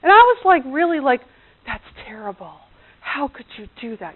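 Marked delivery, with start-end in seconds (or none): 1.7-4.1 softly appalled reproach; hushed, acted dismay; girlish dismay. Her reproaching him about the bird, soft dismay. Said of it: "I was a total girl about it".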